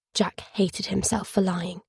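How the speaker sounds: ordinary delivery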